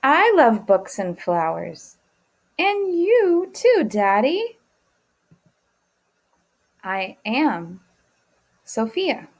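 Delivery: slow tender read-aloud; reading to children. Acted. Reads Alma's reply aloud.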